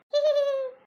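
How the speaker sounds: short high giggle; high laugh. Acted. Sejalben laughs about her mother-in-law's illness, high giggle. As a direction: burst out in a quick giggle. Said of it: "આમ દાંત ના કઢાય".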